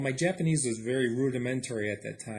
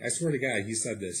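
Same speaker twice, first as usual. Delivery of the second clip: usual speaking voice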